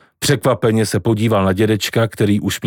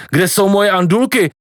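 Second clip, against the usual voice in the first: shouting; 8 semitones higher than usual. A narrator's reading. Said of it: "křičel".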